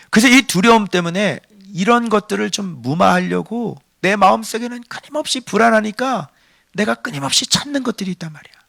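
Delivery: ordinary talk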